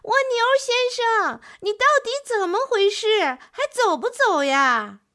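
高声急躁地喊; 不耐烦地冲着窗户喊; 大声喊. A narrator's reading. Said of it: "就大声喊了起来". (乌龟不耐烦地大声喊蜗牛)

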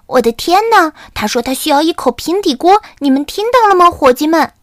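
excited calling out. Acted. Camelido shouts the pan request to his friends, high and excited. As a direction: call the gang in disbelief. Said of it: "卡梅利多喊道".

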